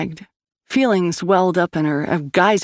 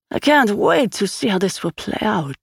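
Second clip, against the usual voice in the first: higher, relishing villain lilt; gleeful, chuckling Swede; 5 semitones higher than usual. A narrator's reading. Swede settling back with his dice, gleeful. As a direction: let amusement colour the words.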